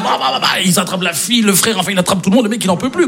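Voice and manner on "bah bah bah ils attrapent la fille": ordinary delivery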